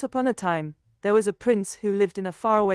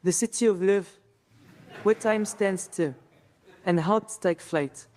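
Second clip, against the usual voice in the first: French accent; 3 semitones lower than usual. Shown as a demonstration. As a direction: recite with a French accent, pausing between lines. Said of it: "Speak with a French accent".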